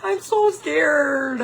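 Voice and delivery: high, drawn-out mock whine of fear; nervous and scared. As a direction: stretch it slowly, high and comically frightened. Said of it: "kind of, like, nervous and scared".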